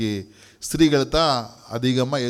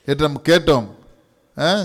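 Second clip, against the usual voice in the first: usual speaking voice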